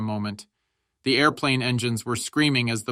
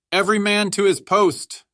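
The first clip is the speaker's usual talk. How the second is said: high, loud command to the troops; urgent battlefield shout; shouted order; 8 semitones higher, 6 dB louder than usual. A narrator's reading. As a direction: bellow it to the whole trench. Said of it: "shouted the Stabs".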